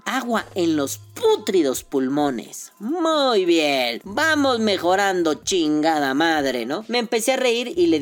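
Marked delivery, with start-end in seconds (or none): 0.0-6.7 slow, drawn-out sarcastic astonishment; mock disbelief. Vaper hearing that vapor puts water in lungs, mock disbelief. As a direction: stretch every phrase in theatrical incredulity.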